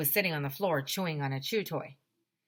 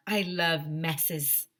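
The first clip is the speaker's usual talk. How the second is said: savoring it; much slower than usual. Acted. Custodian savoring messes.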